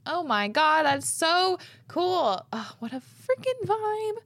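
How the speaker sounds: high and loud, "so cool" stretched out slowly; real delight, high and drawn out; excited. Real, not acted. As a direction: gush like it's the best thing ever. Host reacting to listener letter.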